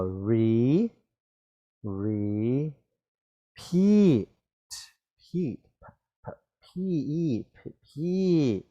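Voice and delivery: "repeat" broken into long, separate "re" and "peat"; sounded out. Shown as a demonstration. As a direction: stretch each syllable of "repeat" for spelling. Breaking word into sounds.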